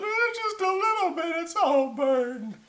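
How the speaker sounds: very high, squeaky creature voice; tiny critter voice, slowing down; sorry little animals. Acted. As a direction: squeak it out, dragging the ending. Animals answer in story.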